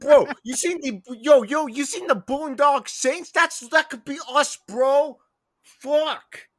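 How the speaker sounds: high, loud, hyped Boston bro. Acted. Friend hyping a movie in a loud high bro voice. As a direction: pitch it way up like an amped Southie kid.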